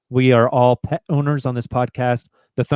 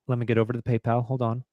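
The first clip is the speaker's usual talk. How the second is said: normal talking voice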